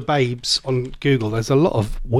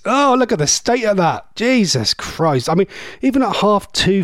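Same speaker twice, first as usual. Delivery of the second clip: normal talking voice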